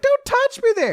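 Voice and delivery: very high, loud yelp; hammed-up high protest; mock girlish squeal. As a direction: squeal it like a startled anime girl. Acts out overheard girl's squeal.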